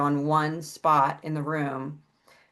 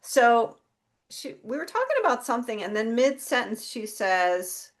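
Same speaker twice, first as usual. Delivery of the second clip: normal talking voice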